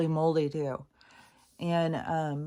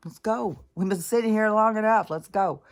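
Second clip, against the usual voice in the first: loud, higher insistent pet voice; pushy dog voice; 5 semitones higher, 7 dB louder than usual. Pretend. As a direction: bark out the dog's demand to go. Voicing the dog demanding a walk.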